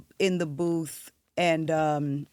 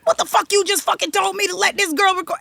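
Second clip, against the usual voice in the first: angry confrontation; 12 semitones higher, 3 dB louder, faster than usual. Retold or imitated. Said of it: "goes up to the producer".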